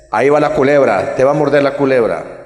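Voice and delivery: put-on loud, menacing father taunting the boy in the dark; father's taunting threat. Priest voicing the father threatening his small son, loud and menacing. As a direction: growl the threat at a scared child.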